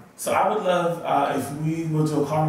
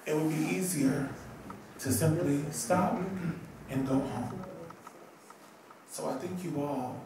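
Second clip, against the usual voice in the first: soft, low, sincere thanks; heartfelt tribute; 3 semitones lower, 10 dB quieter than usual. Real, not acted. Speaker thanking Marsha for a love that saved a life, heartfelt. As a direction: speak softly and from the heart, with pauses.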